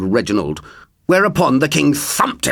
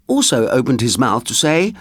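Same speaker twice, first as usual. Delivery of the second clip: ordinary delivery, nothing marked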